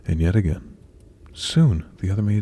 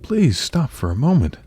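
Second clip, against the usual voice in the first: higher-pitched, pleading prince; raised, imploring storybook voice; urgent plea; 7 semitones higher, 4 dB louder than usual. A narrator's reading. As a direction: call after someone running away. Prince begs Cinderella to stop.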